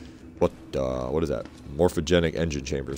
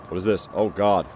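ordinary delivery

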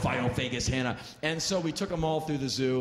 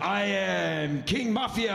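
slow, raised, grand announcement; drunk cab driver; 5 semitones higher, much slower than usual. Retold or imitated. Second drunk driver introduces himself, slow grand boast. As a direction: proclaim the name slowly and proudly. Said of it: "Another guy gets up".

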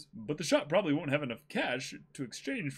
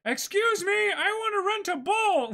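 put-on much higher and louder, bright boyish call; eager Luffy; 5 dB louder than usual. Luffy calls out to the shopkeeper, high and loud. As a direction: barge in like an excited kid.

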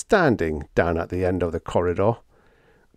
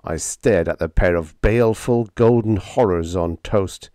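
usual speaking voice

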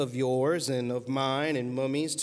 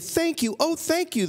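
high, gushing thanks; grateful cry. A narrator's reading. Witch thanking the animals who saved her, high grateful cry. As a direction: thank your rescuers with relief. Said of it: "the grateful witch cried".

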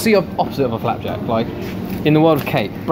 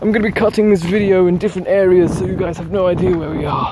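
excited announcement; 6 semitones higher than usual. Real, not acted. Raised-voice announcement to viewers.

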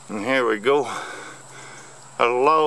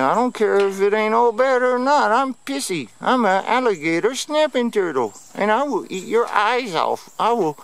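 high, cranky turtle voice; high mock-angry turtle voice; voicing the turtle; 7 semitones higher than usual. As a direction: play the pissy snapping turtle threatening. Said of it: "He said".

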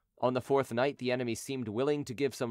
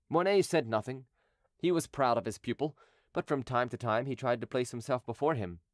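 plain reading voice

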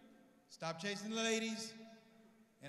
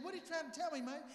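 mom's high squeak; 7 semitones higher, faster than usual. Retold or imitated. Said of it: "She said".

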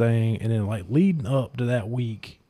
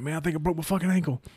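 high, fast, hushed mock panic; panicked report; 6 semitones higher, 4 dB quieter, faster than usual. Wrestler telling his opponent about the ankle, fast high panic. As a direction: rush it out in imitated pain. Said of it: "I'm like".

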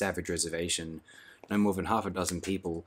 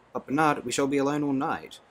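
put-on raised, higher girlish voice for Lenina; a higher, lightly surprised Lenina; Lenina's voice; 7 semitones higher than usual. A narrator's reading. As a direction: lift into a light, higher young woman's voice.

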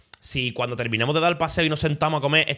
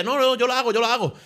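high-pitched, eager volunteering, imitated; mock eager offer; 11 semitones higher than usual. Imitating the lad offering to take the photos, high and eager. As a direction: jump in high, imitating someone keen to help.

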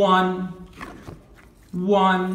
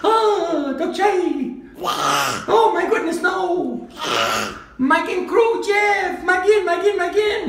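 excited shout; 13 semitones higher, faster than usual. Real, not acted. Teacher excited by child's answer.

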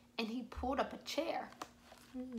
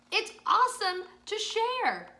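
cheerful Joe voice; 9 semitones higher, 7 dB louder than usual. Acted. Joe's line in children's story.

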